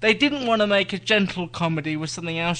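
ordinary talk

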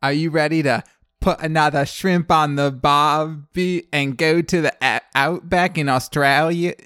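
loud, hammed-up attempt at an Australian accent; bad Australian accent. Host greets co-host Cole in a loud, bad mock Australian accent. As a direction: play the jolly Aussie host, Australian accent overdone. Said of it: "I really cannot do an Australian accent".

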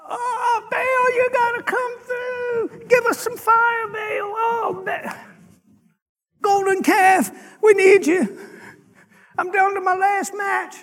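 high-pitched, hammed-up pleading; mock wailing. Four hundred fifty prophets begging Baal, mock wailing. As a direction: wail it out in comic desperation.

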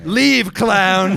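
high, loud hammed-up movie command; laughing mock dramatic order; mock drama line. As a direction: play the stern parent in an indie film. Indie drama scene parody.

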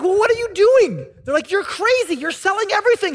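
mocking disbelief. Friends mock him for selling.

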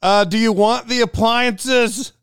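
slow, high, loud yell. Retold or imitated. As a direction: call it out through the wall, slowly. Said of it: "And so I just yell".

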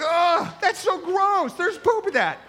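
high, loud, grossed-out yelp through laughter; disgusted son. Retold or imitated.

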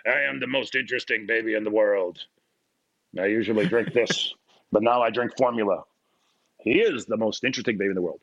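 hammed-up most-interesting-man parody; suave cigar-smoking baby. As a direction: play a worldly cigar smoker.

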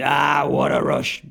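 a slow mock shout of the catchphrase, with a stretched ah; hamming up Hawk's big line. Host quoting the wrestler's catchphrase, in a slow mock shout. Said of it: "And then does the".

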